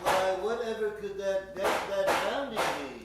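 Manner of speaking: loud, theatrical puzzlement; loud hammed-up wonder. Doctor wonders who pounds on his lab door, loud and hammy. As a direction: ham up the alarm over the noise.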